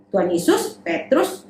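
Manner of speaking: ordinary delivery, nothing marked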